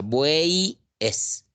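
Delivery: "bueyes" as bueiii, pause, es; slow "bueyes" broken as buei-es; wrong vowel pronunciation. Shown as a demonstration. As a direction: drag the y of "bueyes" into a vowel i, then detach es. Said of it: "O alguien pronuncia".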